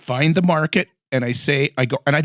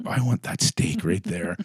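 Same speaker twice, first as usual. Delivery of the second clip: lower, softer, slow murmur; low mutter; 9 semitones lower, 7 dB quieter, slower than usual. Retold or imitated. Watching the buses pass, lower, soft and slow. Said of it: "I'm like".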